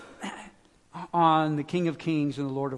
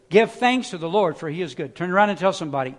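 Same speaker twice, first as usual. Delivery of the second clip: preaching; 3 semitones higher, 5 dB louder than usual. Acted. Urging congregation to respond.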